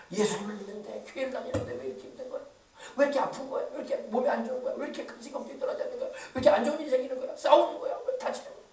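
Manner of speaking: mock churchgoer's complaint, hushed and quick; grumbling at God. Churchgoer griping about hardship, resentful grumbling. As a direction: imitate a soft, rapid string of whining questions. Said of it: "그러면서 막 하나님을 원망하고".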